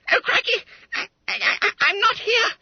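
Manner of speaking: very high, loud squeaky stammer; a terrified fat schoolboy; panicked gasp. Acted. Caught schoolboy to his master, high panicked gasping. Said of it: "gasped Bunter".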